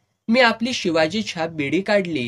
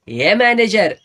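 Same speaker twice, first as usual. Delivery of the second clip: "हे manager" yelled higher and loud, drawn out; higher, louder shout; 5 semitones higher, 8 dB louder than usual. Retold or imitated. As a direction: bellow "हे manager" again, pitched up. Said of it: "ओरडला".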